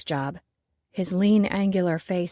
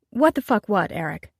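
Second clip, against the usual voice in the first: cool, amused throw-back of his words; unperturbed mocking echo; 3 semitones higher, 4 dB louder than usual. Chef calmly mocking the angry co-owner, unbothered. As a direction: smile and toss it back at him. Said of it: "Unperturbed, the petite chef smiled".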